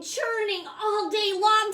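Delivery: ordinary delivery, nothing marked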